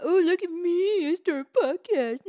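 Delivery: high, loud, slow put-on snob voice; mock boastful voice. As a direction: sneer it like a show-off. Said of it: "they're all making fun of me".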